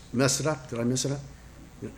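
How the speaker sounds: a sheepish self-check to himself; muttered aside. Real, not acted. Preacher asking himself about the word, muttered.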